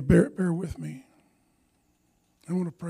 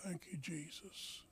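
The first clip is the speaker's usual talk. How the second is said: soft prayer; 13 dB quieter, slower than usual. Real, not acted. Closing whispered thanks.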